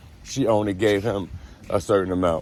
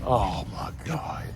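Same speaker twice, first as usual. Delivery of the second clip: slow breathy whisper of disbelief; whispered, slow disgust; 3 semitones higher, 6 dB quieter, slower than usual. Real, not acted. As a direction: whisper it, appalled. Reacting to the disgusting detail.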